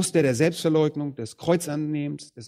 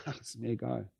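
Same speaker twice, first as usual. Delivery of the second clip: dismissive shrug; 3 semitones lower, 14 dB quieter than usual. Retold or imitated. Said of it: "und sagt".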